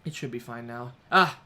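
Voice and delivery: ordinary delivery, nothing marked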